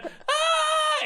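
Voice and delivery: very high short shriek of fright; scared kid scream. Retold or imitated. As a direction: let out a kid's terrified yelp. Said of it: "the other kid went".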